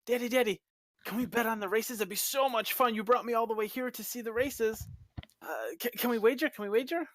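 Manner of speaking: put-on high, whiny girl begging her daddy; pleading young daughter. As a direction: beg like a spoiled young girl. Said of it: "the girl kinda says".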